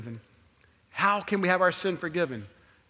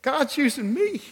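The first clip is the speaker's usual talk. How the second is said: excited; 10 semitones higher, 7 dB louder than usual. Retold or imitated. Retelling his joyful thought.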